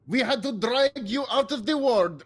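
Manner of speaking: a sailor's mock Swedish accent, scolding. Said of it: "I'm still doing Swedish".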